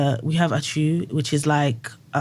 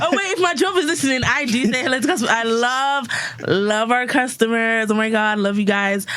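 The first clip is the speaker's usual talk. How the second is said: customer service voice; 8 semitones higher than usual. Shown as a demonstration. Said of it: "my little customer service voice".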